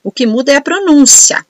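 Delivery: usual speaking voice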